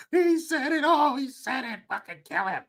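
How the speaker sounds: high, mock-hysterical crowd cry; mock outcry. Mocking those who act out over a word, hysterical outcry. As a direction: start high and drop away, imitating an outraged mob. Mimics mob reacting to the word.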